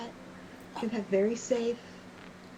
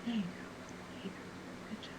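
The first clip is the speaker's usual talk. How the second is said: very soft, hushed encouragement; whispered praise; 16 dB quieter, faster than usual. Acted. Parent praising children for thanking Auntie, whispering. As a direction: murmur approval to a child under your breath.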